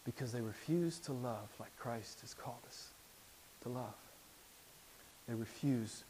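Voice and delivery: preacher pleading softly with brothers and sisters; hushed solemn plea. Acted. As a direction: speak it low and heavy, like a confession.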